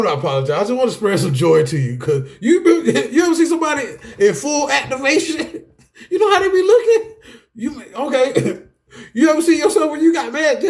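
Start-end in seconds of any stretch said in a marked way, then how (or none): 2.4-10.7 high-pitched talk breaking into real laughter; tickled, laughing. Real, not acted. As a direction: barely get the words out for laughing. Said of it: "Full activation is tickling me today".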